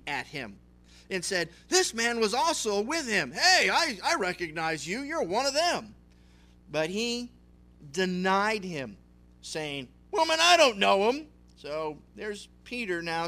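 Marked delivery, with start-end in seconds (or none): 1.7-6.0 put-on accusing servant girl. 10.1-11.3 high, loud protest; loud denial. Acted. Peter denying, voiced loudly.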